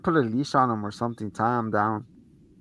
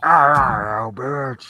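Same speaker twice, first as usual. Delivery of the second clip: Chucky voice; 5 dB louder, much slower than usual. Retold or imitated. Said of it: "renowned Chucky voice".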